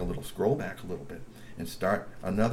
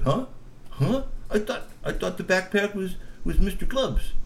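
high puzzled troll; confused Bernie; 9 semitones higher than usual. Acted. Bernie questioning the backpack plan, high puzzled. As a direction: ask in bewilderment.

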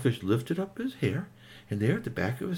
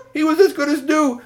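excited; 7 dB louder than usual. A narrator's reading. Joyful narration climax.

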